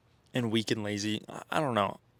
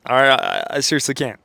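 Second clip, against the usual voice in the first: ordinary talk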